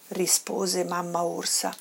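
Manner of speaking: ordinary delivery, nothing marked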